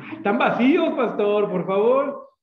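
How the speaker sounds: high, loud scoffing whine; mocking dismissal. Owner scoffing at the pastor's warning, loud high sneer.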